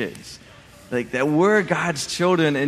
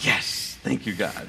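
breathy whispered "Yes, thank you, God"; a relieved prayer under the breath; 6 semitones lower, 6 dB quieter than usual. Retold or imitated. Man whispers his thanks to God as the mower starts.

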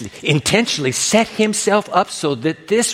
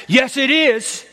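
high, quick-fire retort; defiant comeback in a raised pitch; shooting back; 8 semitones higher, slower than usual. Acted. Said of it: "She shoots back".

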